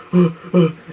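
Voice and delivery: short, repeated mock gasps; imitated gasping in alarm; panicked gasps. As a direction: gasp as if fighting your body. Retold struggle with the eye.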